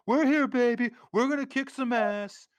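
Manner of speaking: hammed-up swaggering movie hero; Bill Murray impression. Imitating Bill Murray in film.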